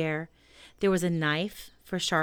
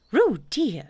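higher, louder motherly reproach; reproachful; 6 semitones higher, 7 dB louder than usual. A narrator's reading. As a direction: scold a little one, still affectionate. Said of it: "said Kanga reproachfully".

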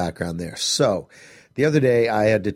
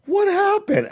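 much higher, startled question; startled teen. Retold or imitated. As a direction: squeak it out in sudden alarm. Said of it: "she's just like".

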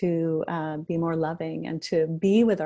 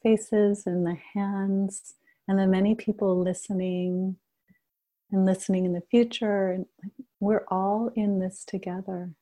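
guided meditation. Acted. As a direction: gently guide the group into shared stillness. Leads a group meditation.

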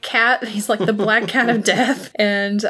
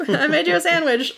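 imitated Roach; 7 semitones higher than usual. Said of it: "which always makes me laugh".

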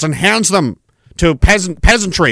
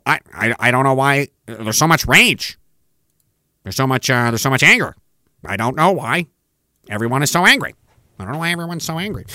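lower, fake-puzzled whine of a smug millionaire; mocking rich politician; 6 semitones lower, 4 dB quieter than usual. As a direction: play the clueless rich guy shrugging off the rage. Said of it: "saying, uh, you know".

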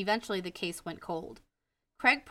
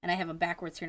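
usual speaking voice

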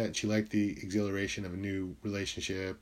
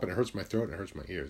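ordinary talk

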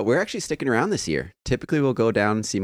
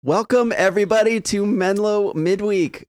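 excited welcome; 10 semitones higher, 3 dB louder than usual. Real, not acted. Show opening welcome.

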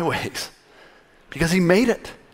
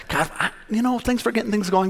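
mock breezy thanks; 6 semitones higher, 4 dB quieter than usual. Preacher mocks people thanking God.

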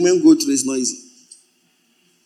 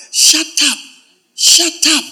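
sharp scolding; 8 semitones higher, slower than usual. Acted. Preacher scolding congregation member.

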